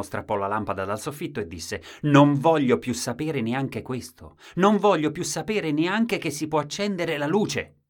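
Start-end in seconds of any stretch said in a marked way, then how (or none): 2.0-7.8 high, loud, furious refusal; angry shout. Acted. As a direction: tear into it, shouting. Husband rips lamp, shouting.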